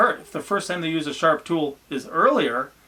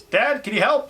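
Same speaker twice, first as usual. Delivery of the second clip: high-pitched imitation of a kid calling Dad; child calling out; 10 semitones higher, 4 dB louder than usual. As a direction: call for help like a little kid.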